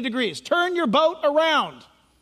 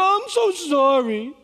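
slow, whiny, lifted "I'm so sorry"; hammed-up hollow apology; mock apology; 3 semitones higher, 3 dB louder than usual. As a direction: parody the caught sinner's empty sorry. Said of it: "until you get busted. And then you go".

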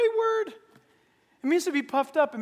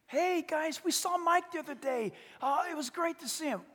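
high, casual mock-friendly chatter; chatty friend; 6 semitones higher than usual. Acted friend mentions seeing Mike, high chatty voice. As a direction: lift the voice like a friend sharing news. Acted gossip opening a chat.

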